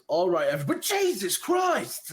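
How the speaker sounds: the guard spots the ogre and jolts; shocked guard. Acted. Guard seeing the ogre, loud high startled yelp. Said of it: "he goes".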